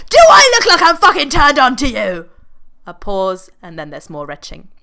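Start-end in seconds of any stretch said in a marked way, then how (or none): 0.1-2.3 high, loud sarcastic yell; furious retort. Acted. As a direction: hurl the question back in outrage. Roger snapping back at Freddy.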